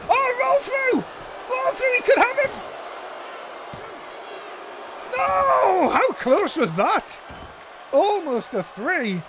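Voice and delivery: high, loud screaming near-fall call; excited shout. Real, not acted.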